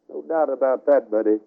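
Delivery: normal talking voice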